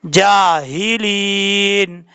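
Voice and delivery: जाहिलीन very slowly, with a full h; heavy chest ha. Shown as a demonstration. Teacher modelling the word, heavy h and long vowels in जाहिलीन. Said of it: "इस तरीके से पढ़ा जाएगा".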